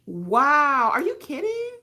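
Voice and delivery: high, stunned question; disbelief. Real, not acted. Host stunned by a viewer's confession, high disbelief. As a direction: gasp out the disbelief.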